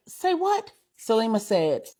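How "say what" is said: very high, incredulous rising question; stunned disbelief. A narrator's reading. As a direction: balk at the confession in astonishment. Husband shocked by wife's confession.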